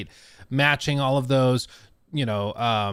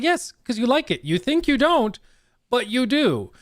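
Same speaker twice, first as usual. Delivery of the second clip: teasing told-you-so; 10 semitones higher than usual. Retold or imitated. Teasing toddler who refused chicken.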